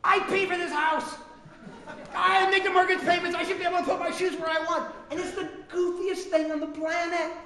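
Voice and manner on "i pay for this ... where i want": mock shouting, laughing through it; hammed-up protest at the wife; mock indignant. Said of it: "I said".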